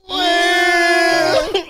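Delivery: high laughing drawn-out well; imitating Stacy. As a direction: stretch the word, laughing. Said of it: "he delivered it like".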